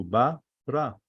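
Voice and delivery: "Bara" broken into two syllables, Ba, ra; divided in two. Shown as a demonstration. Teacher showing that bara is two, syllables separated. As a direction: split "Bara" with a short gap in the middle. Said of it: "bara is two".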